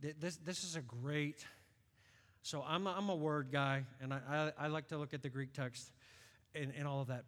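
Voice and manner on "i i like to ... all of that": ordinary delivery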